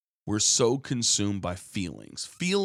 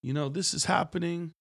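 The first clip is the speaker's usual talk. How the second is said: higher, lightly sung-out news calls; hawking headlines; 8 semitones higher than usual. Retold or imitated. Preacher imitates paper boys calling out news, higher pitched. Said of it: "they would tell you about the day's headlines".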